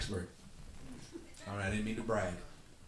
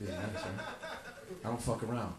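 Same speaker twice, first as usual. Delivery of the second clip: normal talking voice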